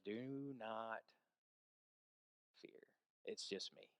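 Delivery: low, soft voice of Jesus; gentle reassurance. Retold or imitated. Jesus to terrified disciples on the water, gently reassuring. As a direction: reassure them softly, with pauses.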